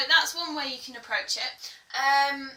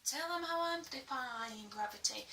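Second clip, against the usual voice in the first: quietly spoken; 2 semitones higher, 10 dB quieter than usual. Shown as a demonstration. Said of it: "especially when you're doing".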